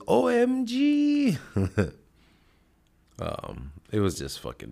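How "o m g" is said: high, slow, drawn out O M G; imitating an auntie. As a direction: stretch each letter like an appalled auntie. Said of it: "one of them goes".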